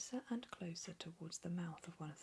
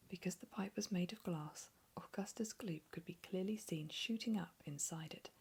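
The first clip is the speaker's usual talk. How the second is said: plain reading voice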